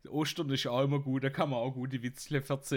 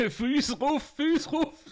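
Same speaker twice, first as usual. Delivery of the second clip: high, laughing, louder; laughing exclaim; 12 semitones higher, 6 dB louder, slower than usual. Real, not acted. Comedian cracking up at his own joke, laughing high. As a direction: blurt it out through the laughter.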